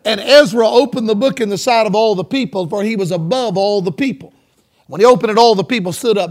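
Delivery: loud, ringing Bible reading; scripture reading. Real, not acted. Preacher reading Ezra's story to congregation, loud scripture reading. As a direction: proclaim the passage to the whole church.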